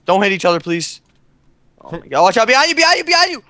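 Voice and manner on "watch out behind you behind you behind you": urgent shout. Real, not acted. Warning teammate.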